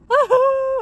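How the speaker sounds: high loud laugh. Real, not acted. Laughing before crying story.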